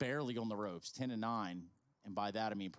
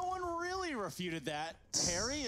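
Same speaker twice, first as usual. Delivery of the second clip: teasing; 13 semitones higher, 3 dB louder than usual. Real, not acted. Teasing a co-caster after the name mix-up.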